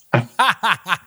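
laughing through the word. Real, not acted. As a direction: breaks into a laugh. Laughing at own joke.

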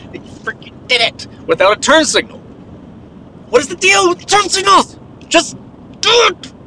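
high, climbing to a loud do it; high-pitched road rage; exasperated outburst. Real, not acted. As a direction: vent at the bad driver. Angry driving rant.

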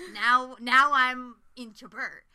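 slow, loud, drawn-out confession; mock confession. Real, not acted. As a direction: admit the crush dramatically. Admitting new crush.

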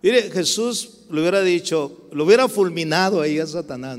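ordinary talk, nothing marked

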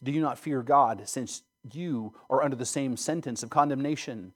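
normal talking voice

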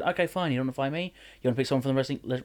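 ordinary talk

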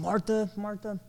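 mock angry Martha, Martha, pitched high. Shown as a demonstration. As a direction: snap Martha, Martha at her, high. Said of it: "not saying in an angry tone".

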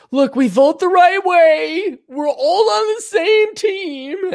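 high, slow mocking whine. Mocking homeless people voice.

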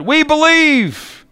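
high, slow hammed-up cheer; mock crowd calling out its faith; crowd shout. Crowd at Niagara Falls cheering Blondin, high mock shout. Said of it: "some in the crowd shouted".

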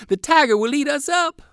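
put-on high, loud child's wail; fleeing boys screaming about the tiger; panicked shout. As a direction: yell it while running away. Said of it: "shouted both boys together".

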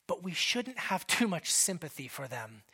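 whispered aside. Real, not acted. Preacher whispering an aside to the congregation. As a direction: lean in and whisper it.

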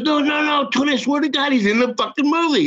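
high-pitched imitation of a pleading agent; retold emphatic insistence; insistent swearing.

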